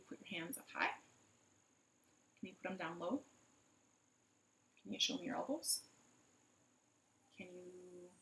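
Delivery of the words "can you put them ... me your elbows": ordinary delivery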